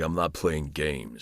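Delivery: low, slow character quote; Batman line. Retold or imitated. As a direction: deliver it deep and slow, like Batman. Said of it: "that begins off like".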